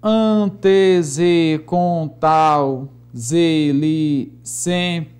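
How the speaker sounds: very slow, stretched syllables; measured metrical chanting; syllable-by-syllable scansion. Shown as a demonstration. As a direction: draw out every beat of the verse. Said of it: "vamos escandear aqui alguns versos".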